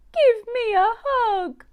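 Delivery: high, loud, wailing plea; wailing little mouse. Acted. Hurt mouse Missy wailing to her mum for a hug. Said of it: "wailed Missy".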